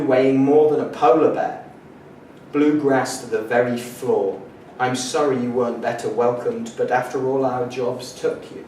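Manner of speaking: declaimed, measured poetry recital; poetry reading. Acted. Poet reads a collage of modernist fragments to an audience, declaimed. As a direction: read each fragment out deliberately with projection.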